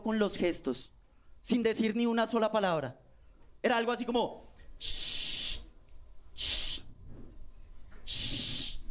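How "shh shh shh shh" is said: long unvoiced shushing hiss. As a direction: shush the other kid silently. Niño mandando callar con gestos. (siseo largo para mandar callar)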